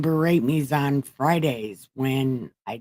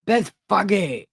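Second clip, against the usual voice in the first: higher-pitched read-aloud voice, halting at names; reading scripture aloud; 4 semitones higher than usual. Acted. Reader going on to chapter twenty-one, reading aloud. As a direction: read out the verse, feeling out the hard name.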